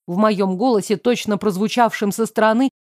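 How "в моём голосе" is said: ordinary delivery, nothing marked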